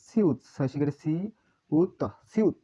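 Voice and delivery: সি and উত said as two separate, stretched syllables. Shown as a demonstration. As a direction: split সিউত into সি, then উত. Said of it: "সিউতের উচ্চারণ". (সি আর উত আলাদা করে টেনে উচ্চারণ)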